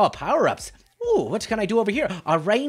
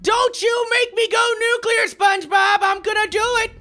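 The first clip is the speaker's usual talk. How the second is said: mock fury; 8 semitones higher than usual. Real, not acted. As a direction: build up the threat like a villain. Threatening SpongeBob.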